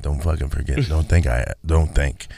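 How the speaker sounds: a menacing mock wife, low and hushed; low menacing threat. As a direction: growl it under your breath as a warning.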